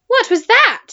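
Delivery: sudden loud startled shout; a jolted boy shouting across the room. A narrator's reading. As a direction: yell it out in fright. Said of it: "he shouted to Eric".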